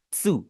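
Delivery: त्सु breathed out, a closed-teeth t sliding into su; voiceless त्सु, teeth opening at the end; whispered tsu. Shown as a demonstration. Teacher repeats the syllable to show the teeth: breathy त्सु. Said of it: "शुरू में आपके दांत बंद होने चाहिए".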